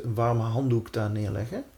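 ordinary talk

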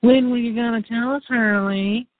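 high, whiny complaining voice; whiny Nikki voice. Retold or imitated. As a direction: whine it up high like a spoiled girl. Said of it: "I'm gonna do the whiny voice".

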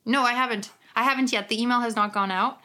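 normal talking voice

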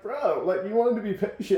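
high, loud, incredulous God talking like a buddy; exasperated God. Retold or imitated. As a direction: call out a mock reproach from above. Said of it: "and God's up there, he's just like".

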